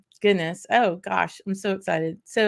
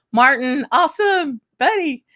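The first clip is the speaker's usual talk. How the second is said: much higher, louder cheer for a friend; excited greeting; 7 semitones higher, 7 dB louder than usual. Real, not acted. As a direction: cheer a viewer's name like a win.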